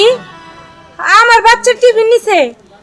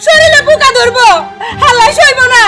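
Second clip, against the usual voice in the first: tearful cursing. Acted. Mother curses bullies who took her sick child's tiffin, tearful cursing. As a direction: wail curses at them through tears.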